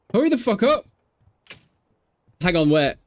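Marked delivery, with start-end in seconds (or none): 0.1-0.8 high, pushy yell of impatience; exasperated hurry. Real, not acted. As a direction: hurry them along, fed up. Urging Sims to hurry.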